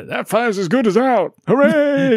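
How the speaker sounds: high, loud cheesy crowd cheer; hammed-up cheer. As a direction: overdo a dubbed crowd's joy. Mocking overdubbed crowd lines.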